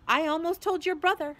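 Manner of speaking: high, loud, cross retort; snapping. A narrator's reading. Hermione threatens to tell on Ron, snapping. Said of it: "Hermione snapped".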